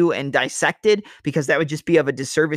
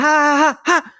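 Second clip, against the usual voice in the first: sharp high "ha" shouts, much higher and louder; imitating Holly Holm's kiai; 11 semitones higher, 6 dB louder, slower than usual. Fight commentator mimics Holly Holm's strikes with high kiai shouts. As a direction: bark out quick fighter's kiai bursts like Holly Holm throwing. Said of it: "That's Holly Holm".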